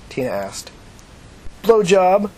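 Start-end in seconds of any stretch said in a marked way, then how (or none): 1.6-2.3 forced confidence. Acted. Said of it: "the man said with forced confidence".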